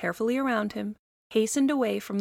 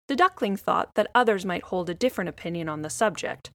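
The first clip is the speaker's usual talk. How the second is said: ordinary delivery, nothing marked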